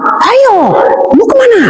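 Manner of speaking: high-pitched mock catching call; acted challenge. Relatives catching the fleeing woman, acted high-pitched challenge. Captors catch her as she flees.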